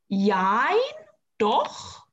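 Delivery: ordinary talk, nothing marked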